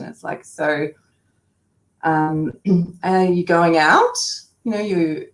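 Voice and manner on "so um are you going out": "out" swooping up at the end; rising question inflection. Shown as a demonstration. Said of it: "the rising inflection at the end of the question".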